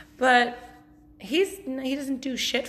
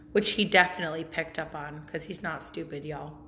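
ordinary talk, nothing marked

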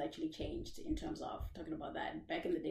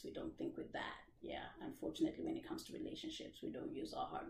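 softer and breathy, fast; whispered aside; 4 semitones lower, 11 dB quieter, faster than usual. Real, not acted. Host confiding a whispered aside to her viewers. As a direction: lean in and whisper it.

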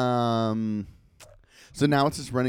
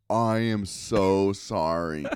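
slow apology; 3 dB louder, much slower than usual. Retold or imitated. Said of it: "And I was like".

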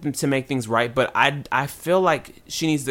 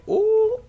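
high, loud scandalized "ooh"; mock crowd "ooh"; 5 dB louder than usual. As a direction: imitate an audience reacting to gossip. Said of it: "everybody on Twitter was like".

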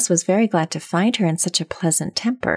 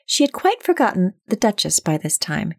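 ordinary delivery, nothing marked

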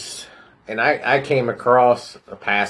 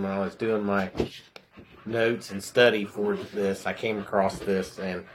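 usual speaking voice

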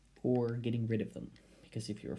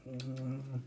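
usual speaking voice

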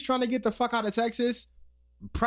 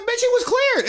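exasperated retort; 6 dB louder than usual. Retold or imitated. Imagined retort to the lady.

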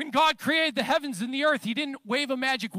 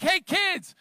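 put-on higher-pitched call to the kids; mock yell; 6 semitones higher, 5 dB louder than usual. Dad calling to his kids out swimming, mock yell. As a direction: call out as if yelling, only moderately loud. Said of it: "I'll yell at 'em".

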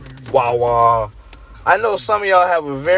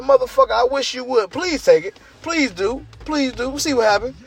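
daring threat; 8 semitones higher than usual. Retold or imitated. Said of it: "I said".

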